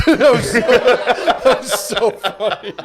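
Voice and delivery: laughing hard, slow and breathy; can barely get it out for laughing; laughing through a slowed phrase. Real, not acted. Laughing at own memory.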